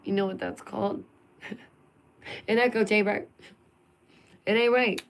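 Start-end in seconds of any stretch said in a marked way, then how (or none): 4.5-5.0 louder and higher, punching right; emphatic protest. Real, not acted. To her viewers, a raised emphatic protest. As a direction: declare it ain't right, raised up. Vlogger repeats the line twice.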